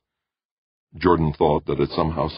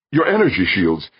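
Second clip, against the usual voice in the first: urgent, louder call; fairly shouted; 5 semitones higher, 6 dB louder than usual. Acted. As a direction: burst out with the idea. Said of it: "Jordan fairly shouted".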